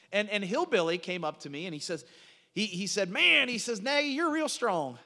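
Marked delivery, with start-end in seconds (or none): 3.1-3.5 high, loud country drawl greeting; Hillbilly. Retold or imitated. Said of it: "This is literally how he talks". 3.8-5.0 slow, high-pitched country drawl; Hillbilly. Retold or imitated. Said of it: "This is literally how he talks".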